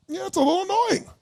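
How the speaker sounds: unbothered partner. Retold or imitated. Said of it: "doesn't even look slightly upset".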